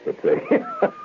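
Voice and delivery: high giggle cutting off the words; cracking up mid-sentence; breaking into a laugh. Real, not acted. Radio host laughing at his own line.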